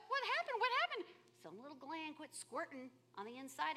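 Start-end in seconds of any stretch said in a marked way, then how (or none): 0.1-1.0 high mock alarm, what happened; puzzled asking. The body wondering why a gland quit, high and puzzled. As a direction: ask it up high, baffled.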